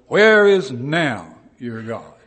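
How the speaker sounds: loud, slow mocking shout; yelled taunt. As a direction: hurl the taunt at God's people. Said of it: "that's yelled at God's people".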